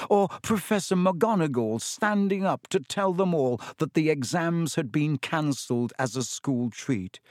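ordinary delivery

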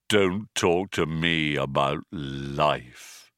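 slow, low, doleful drone; depressed robot, utterly fed up; bitter gloom. A narrator's reading. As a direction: drag it out with world-weary disgust. Depressed robot rebuffs her bitterly.

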